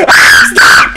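loud cry of alarm; panicked shout. Real, not acted. Gamer finds himself stuck mid-chase, panicked shout. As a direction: shout out as if suddenly trapped. Caught while fleeing in game.

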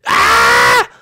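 harsh monster cry; creature scream. Pretend. Creature doused with water, screaming. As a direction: scream out as a cartoon beast.

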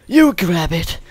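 mock creepy, breathy character voice; weirdo voice. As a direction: mimic the kinky-sounding weirdo. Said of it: "He sounds like a right weirdo".

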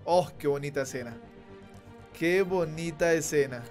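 ordinary talk, nothing marked

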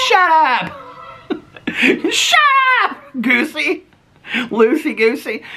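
high, giggly playful scolding; laughing squeal. Real, not acted. Laughing at her pet.

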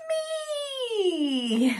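long drawn-out high note; boastful animal voice. A narrator's reading. Character claims the credit, long high boast. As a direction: stretch it out proudly.

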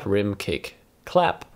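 ordinary talk, nothing marked

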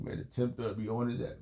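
ordinary talk, nothing marked

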